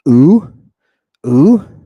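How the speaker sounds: "Oo" held long and loud; long upsilon. Shown as a demonstration. Teacher showing students the long version, loud drawn-out "oo". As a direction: push out a long, rounded "oo" vowel. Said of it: "The upsilon has a long version".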